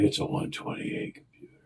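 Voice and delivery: hushed whisper of a salesman; whispered reply from the store clerk. Retold or imitated. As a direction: breathe it like a secret. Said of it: "They're like".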